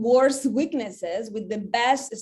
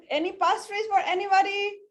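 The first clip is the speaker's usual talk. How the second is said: laughing offer; 9 semitones higher than usual. Real, not acted. Offering pastries, laughing to staff.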